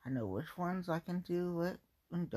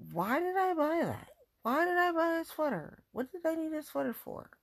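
exasperated self-scolding; 11 semitones higher, 5 dB louder than usual. Real, not acted. Scolding herself over sweater purchases.

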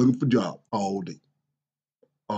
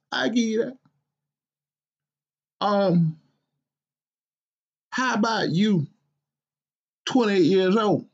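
a grumpy father's higher, exasperated voice; scolding dad; 8 semitones higher than usual. Acted. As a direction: scold your lazy grown son, incredulous. Father rants at his grown son.

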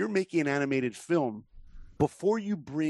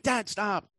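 put-on whining kids; 8 semitones higher than usual. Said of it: "They're like".

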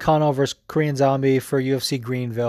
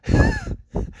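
real laugh, very high pitched; a quick squeal of laughter; short high laugh. Real, not acted. Laughing at the tattoo story.

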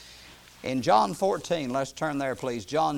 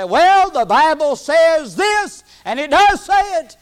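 high, loud mock argument; insistent protest; 12 semitones higher, 14 dB louder, slower than usual. Preacher imitating people who misread the Bible, loud insistence. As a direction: belt it out as a stubborn objection.

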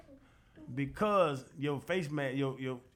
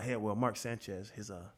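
hushed tip-off; 7 semitones lower, 9 dB quieter than usual. Retold or imitated. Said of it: "He be like".

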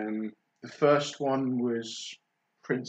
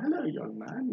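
high-pitched posh royal greeting; high, posh royal English accent; 10 semitones higher, 4 dB quieter than usual. Retold or imitated. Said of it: "in the posh royal accent".

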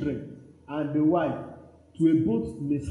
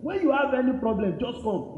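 high, effusive mock assurance; imitated eager promisers; 7 semitones higher than usual. Father's friends promising help to a bereaved son, imitated eager assurance.

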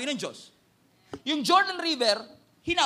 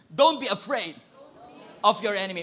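louder, firm "Don't be afraid of your enemy"; with conviction; 6 dB louder than usual. Shown as a demonstration. Preacher leading the congregation to declare it with conviction. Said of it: "Say it with conviction".

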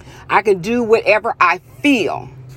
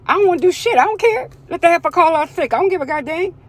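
high-pitched mock indifference; mocking defiance; 8 semitones higher, faster than usual. Worker refusing to deal with call-out, mock defiance. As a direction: imitate a worker blowing it off, pitched way up. Acting out a worker who doesn't care.

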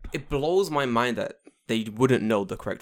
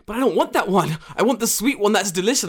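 put-on high, louder whining child; whiny kid; 10 semitones higher, 7 dB louder than usual. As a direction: beg like a disappointed student. Kids voiced in story.

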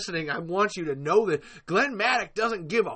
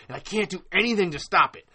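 usual speaking voice

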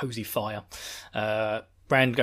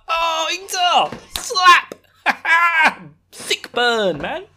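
high, loud, stretched-out cheering at a burn; hyped reaction; 10 dB louder, much slower than usual. Real, not acted. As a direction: cheer the roast like a delighted fan. Reacting to Ingtar's insult.

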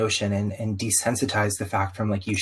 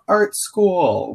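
mock delighted announcement; 10 semitones higher, 5 dB louder than usual. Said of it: "So for me to grow up and be like".

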